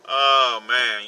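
normal talking voice

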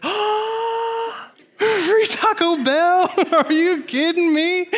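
high, slow, gasping mock-delighted squeal; overjoyed squeal. Preacher retelling his reaction to free Taco Bell, squealing with joy. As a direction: squeal with joy like a kid given a gift.

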